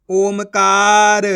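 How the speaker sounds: ओमकार very slow and loud, long का; blended word reading. Shown as a demonstration. Teacher showing what the letters make, slow blending. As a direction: stretch ओम-कार right out. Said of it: "तो मिलके बन जाएगा".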